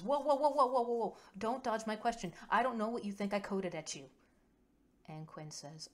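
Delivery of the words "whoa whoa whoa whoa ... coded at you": high, loud volley of protest; urgent interruption. A narrator's reading. Kova lurches forward to stop Quinn dodging, urgent and loud. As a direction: cut in high and loud, then rush on. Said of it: "lurches forward and says".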